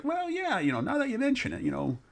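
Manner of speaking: high, hammed-up voice for the cousin; mock cousin's reply. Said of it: "And he's like".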